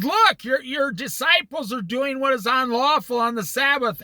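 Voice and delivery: hammed-up accusing yell; mock shout. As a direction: Pharisees shouting accusation at Jesus. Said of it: "they said".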